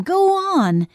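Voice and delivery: high, loud coaxing push; insistent urging. A narrator's reading. Boy shakes his friend's arm, loudly insistent. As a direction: urge them on, stretching it out. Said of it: "he insisted".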